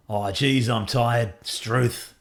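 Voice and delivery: put-on cheery Aussie bloke in a broad Australian accent; silly Aussie accent. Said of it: "with a silly accent".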